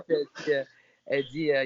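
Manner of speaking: ordinary delivery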